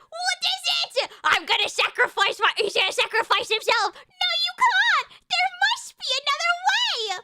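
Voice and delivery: dramatic movie heroine. Acted. Streamer parodying a Harry Potter sacrifice, melodramatic high cry. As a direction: plead in melodramatic despair.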